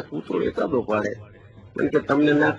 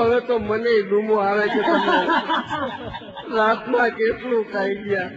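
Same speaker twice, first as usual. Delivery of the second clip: laughing complaint; 5 semitones higher than usual. Retold or imitated. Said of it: "મને છે ને મોઢે ડૂમો આવે".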